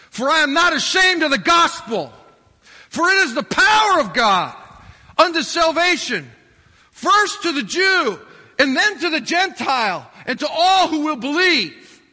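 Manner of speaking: loud, high, driving preacher's voice; preaching at full force. Acted. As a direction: proclaim it to the back row. Paul quoted, preached out.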